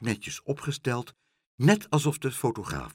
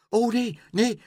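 flustered cry; 12 semitones higher, 5 dB louder than usual. A narrator's reading. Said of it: "riep mevrouw Helderder met een kleur".

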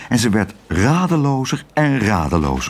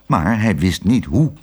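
usual speaking voice

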